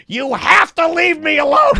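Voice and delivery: high-pitched re-enacted yell; retold scream. Teased boy to his bully at breaking point, re-enacted scream. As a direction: act out screaming it in his face. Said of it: "I screamed at his face".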